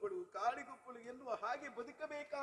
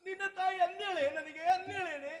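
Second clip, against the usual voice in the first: louder, higher, pleading cry to the daughter; pained stepmother crying out; anguished outcry; 4 semitones higher, 8 dB louder than usual. Acted. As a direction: throw the hurt out loud as a stepmother.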